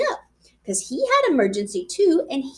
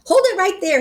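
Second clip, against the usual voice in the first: hollering; 7 semitones higher, 4 dB louder than usual. A narrator's reading. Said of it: "he hollered".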